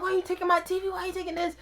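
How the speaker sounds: put-on high, pleading kid's complaint; high-pitched protest. Teen to mom taking his TV, high protest.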